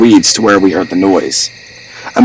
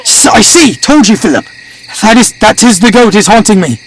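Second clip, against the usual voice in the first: high-pitched agitated yell; 13 semitones higher than usual. A narrator's reading. Hunter tells Philip the goat haunts him, high yell. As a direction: cry it high, stumbling in alarm. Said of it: "I yelled".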